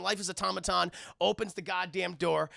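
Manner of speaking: ordinary talk, nothing marked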